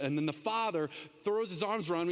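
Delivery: normal talking voice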